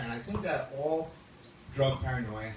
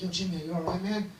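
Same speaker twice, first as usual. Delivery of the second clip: higher-pitched imitation of worried buddy; concerned friend; 6 semitones higher than usual. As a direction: check on a panicking pal. Worried friend comes running.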